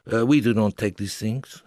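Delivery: lightly put-on French accent, curt; rude French official. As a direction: brush them off in a mild French accent. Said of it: "he offered rudely".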